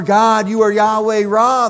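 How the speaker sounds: loud, ringing proclamation of God's name; building praise. Acted. Preacher proclaiming God's names to congregation, loud and ringing. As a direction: proclaim it with full force.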